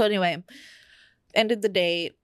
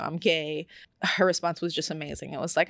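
usual speaking voice

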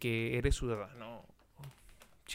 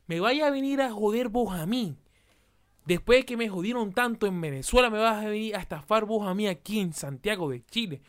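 raised, heated and higher; indignant outburst, higher and louder; angry rant; 6 semitones higher, 4 dB louder than usual. Acted. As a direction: tell off the scammer in your head. Angry inner rant at scammer.

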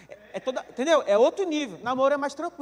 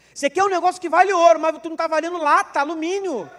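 louder, rising rebuke; loud scolding; 3 semitones higher, 8 dB louder than usual. Real, not acted. Pastor to the single men: loud mocking rebuke. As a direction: hammer the point home. Preacher scolds the young man.

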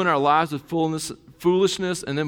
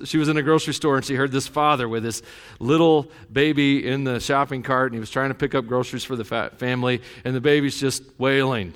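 ordinary talk

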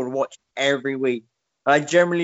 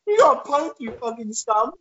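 much higher sneering taunt; mock gloat; 9 semitones higher than usual. Boris taunting his prank victim, much higher and sneering. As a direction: spit it out like a smug toff. Prank show impression continued.

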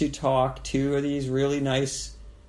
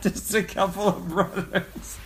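high, slow words through laughter; laughing; 7 semitones higher, 4 dB louder, slower than usual. Real, not acted. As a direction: barely get words out laughing. Cracking up at the joke.

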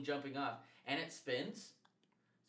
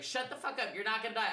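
imitating a scolding mom; 8 semitones higher, 5 dB louder than usual. Mom scolding scared son.

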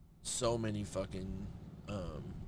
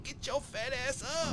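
very high-pitched mock female voice; imitated woman; 13 semitones higher than usual. Lover ordering him up, high mock woman voice. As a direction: squeak it out as the retold lover. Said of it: "She said".

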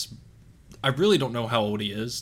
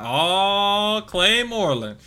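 high, loud, excited hello; imitating Kevin's greeting; 10 semitones higher, 8 dB louder, much slower than usual. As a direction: greet an old buddy, playing up his delight. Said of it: "he's gonna be like".

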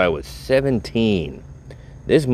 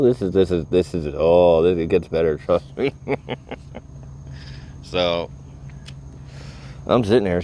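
normal talking voice

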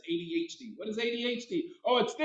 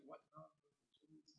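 barely audible, slow mock whisper; hushed retort; 3 semitones lower, 36 dB quieter than usual. Kid answering a teacher's accusation, hushed retort. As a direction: whisper it under your breath. Bewildered reply to being scolded.

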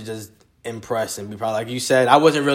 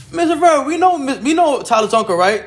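much higher, amused teasing voice; principal's knowing tease; 12 semitones higher, 4 dB louder than usual. Retold or imitated. Principal teasing a student about his uncle, high and knowing. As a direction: tease the kid like you have his number. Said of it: "she was like".

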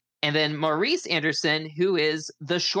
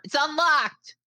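high, louder call from behind a closed door; shouted through door; 12 semitones higher, 3 dB louder, slower than usual. Acted. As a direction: yell it through the door to someone outside.

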